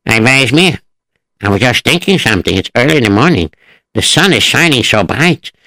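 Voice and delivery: worried farmer thinking to himself; Zelig the farmer. Acted. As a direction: muse aloud as a simple villager. Zelig voiced in story.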